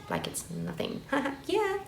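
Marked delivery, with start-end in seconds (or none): 1.5-1.9 laughing. Real, not acted. Laughs at her own point.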